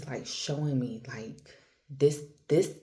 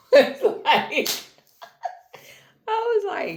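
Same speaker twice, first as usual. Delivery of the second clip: high, breaking into laughter; can't get the words out for laughing; 7 dB louder than usual. Real, not acted. Retelling how her friend packed up, laughing high.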